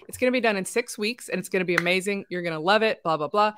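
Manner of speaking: assured, matter-of-fact promise, steady and falling away at the end; confident answer. Shown as a demonstration. Coach models answering a client about the deadline, confident. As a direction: state "six weeks" as settled fact, no question. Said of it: "you're faking that confidence".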